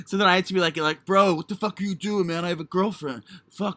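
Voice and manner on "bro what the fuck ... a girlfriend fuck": higher bro voice, like a Ninja Turtle; hammed-up straight dude protest; put-on straight-guy voice. Waking up to being fondled in role play, put-on straight-guy voice. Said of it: "why do you sound like a Ninja Turtle".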